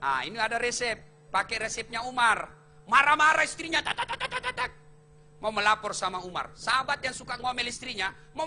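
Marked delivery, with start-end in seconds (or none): 3.9-4.7 high rattling tak-tak of a nagging wife; rapid nagging chatter. Retold or imitated. Mocking a wife scolding her husband with high rapid chatter.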